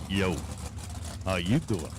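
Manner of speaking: low, lightly voiced New York greeting; New York accent. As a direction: try out a New York "how you doing". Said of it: "Is that what it is?".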